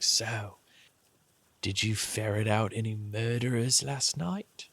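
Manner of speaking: understated, sly question with a half smile; half-smiling tease. Acted. Lady pulling the player in close, half-smiling question. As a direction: ask it lightly, teasing. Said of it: "Sort of, like, with a half smile".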